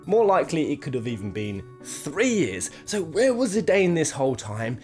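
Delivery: ordinary delivery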